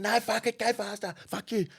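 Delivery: high, heated outburst; mock yelling. Woman to driver, both off their heads, mock yelling. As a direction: imitate a wired passenger yelling at the driver. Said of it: "they're just yelling at each other".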